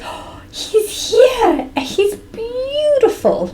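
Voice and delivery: very high, slow, rapturous gush; lit-up delight. Retold or imitated. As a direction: imitate mom lighting up with wonder. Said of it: "lights up".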